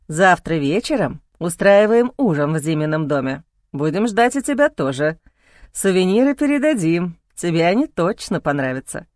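a hint of a friendly father figure; man's voice. A narrator's reading. Male character voice.

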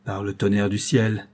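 plain reading voice

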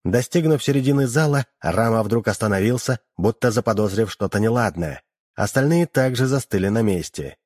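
ordinary delivery

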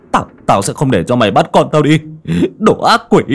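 desperate, angry yelling; furious defiance. Acted. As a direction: build to a high, loud shout. Father drives off the demon.

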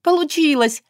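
it worked, shout it with joy; bursting elated exclamation; joyful exclaim. A narrator's reading. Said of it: "воскликнула удивлённо".

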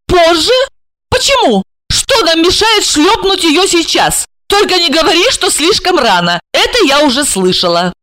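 high-pitched incredulous questions; high female voice, outraged disbelief; stunned, indignant woman. Acted. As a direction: challenge him, pitched high. Plays Regina, stunned and indignant.

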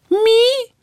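very high piping squeak; creature squeak. A narrator's reading. Small creature answers frightened heroine, high piping squeak.

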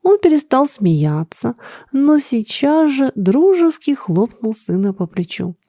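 ordinary delivery, nothing marked

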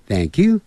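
"Thank you" with the pitch lifting on "you"; rising "Thank you". Shown as a demonstration. Teacher offers another way to say it, rising "Thank you". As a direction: end "Thank you" on a rise. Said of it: "或者是念".

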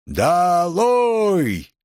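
high, drawn-out crowd call; long jeering yell. A narrator's reading. Students shouting Nicole down, long drawn-out yell. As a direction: stretch both syllables into a long jeer.

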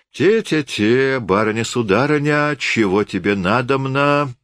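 high, slow tipsy banter; a merry drunk forester hollering; rowdy call. A narrator's reading. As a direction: tease the lady grandly. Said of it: "разгульно крикнул лесник".

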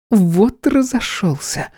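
high, slow indignant exclaim. Acted. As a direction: act indignant at the rain. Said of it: "возмутилась она".

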